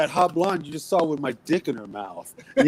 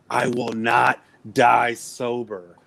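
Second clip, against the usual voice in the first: slow quoted line; 5 semitones lower, much slower than usual. Retold or imitated.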